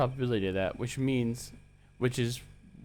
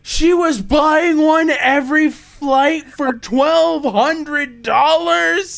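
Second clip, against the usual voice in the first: shocked shout; 13 dB louder, much slower than usual. Real, not acted. Shocked at suitcase price.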